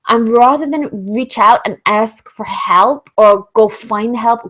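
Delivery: usual speaking voice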